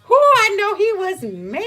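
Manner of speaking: high and loud, through laughter; laughing, still loud. Real, not acted. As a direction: keep the giggle going, gloating. Laughing right after her retort.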